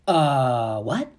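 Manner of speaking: imitated stunned reaction; drawn-out puzzled sound, then a rising question; jaw-dropped disbelief. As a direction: act out a baffled double take. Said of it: "jaw dropping. My face was like".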